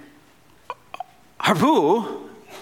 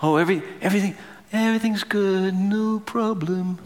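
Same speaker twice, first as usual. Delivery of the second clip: airy, higher mock carefree voice; a breezy everything's fine, lightly mocked; 5 semitones higher than usual. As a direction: toss it off like nothing's wrong. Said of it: "something that is just like".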